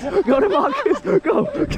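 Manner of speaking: excited cheer, pitch climbing. Real, not acted. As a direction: urge him on, laughing. Urging a jumping friend on.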